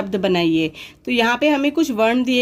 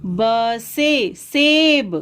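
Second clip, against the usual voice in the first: 1 slow spelling; much slower than usual. Shown as a demonstration. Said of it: "spelling out letters".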